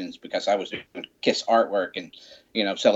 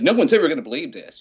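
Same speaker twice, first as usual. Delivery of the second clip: put-on excited kid; 5 semitones higher, 6 dB louder, faster than usual. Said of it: "because he's like".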